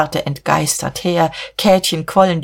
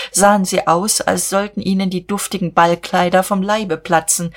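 usual speaking voice

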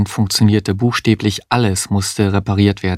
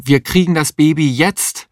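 high, urgent insistence; acted high-pitched protest; defiant cry; 7 semitones higher than usual. A narrator's reading. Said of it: "rief Mario".

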